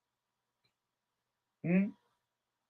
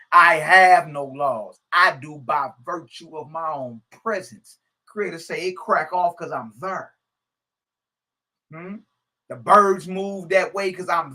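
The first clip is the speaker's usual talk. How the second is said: proclaiming scripture; 3 semitones higher, 6 dB louder than usual. Acted. Preacher reading the creator's words to his audience, proclaiming. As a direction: read it as the creator's decree.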